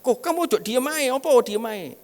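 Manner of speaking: high, loud nagging wife voice. Retold or imitated. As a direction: play the wife pestering him about his silence. Wife nags at the traffic light. (istri yang mengomel dengan suara tinggi dan keras)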